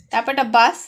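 ordinary talk, nothing marked

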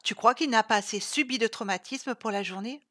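outraged mother; offended. Acted. Mother scolding Chloé's incision idea, indignant. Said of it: "s'offusqua sa mère".

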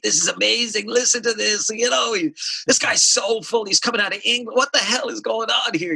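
put-on high, thrilled young fan; high excited teen fan voice; excited teenage me. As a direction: gush like a teen hearing it first. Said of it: "being like".

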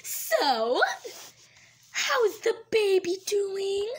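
loud, slow bright greeting; cheery doctor voice. Acted. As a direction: play a chirpy doctor.

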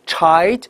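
put-on the word Child said without its L, like chite; no dark L. Shown as a demonstration. Teacher gives the contrast word to learners, L left out. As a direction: drop the L from Child, vowel straight to the stop. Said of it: "你这个L一定要学好".

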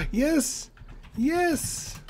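very high, loud shriek of joy; overjoyed at a surprise gift; excited yes. Real, not acted. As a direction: cheer like you just won. Got the tank gift.

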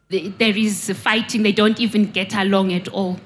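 ordinary delivery

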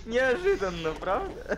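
high, mocking surprise; sarcastic "how unexpected".

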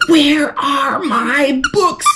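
loud, slow angry yell. A narrator's reading. Boy yelling at his sister about his books, loud and slow. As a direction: bellow it at his little sister. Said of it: "I think he's yelling at his sister".

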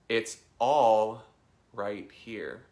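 slow mantra leaning on "all"; stress on all. Shown as a demonstration. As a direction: punch a long "all", pause, then ease off. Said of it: "emphasize a different word".

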